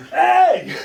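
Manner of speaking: high, loud mock bellow across the room; imitated shout. Big ballplayer yelling to the singer across the room, mock shout. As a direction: call him over like a big man yelling.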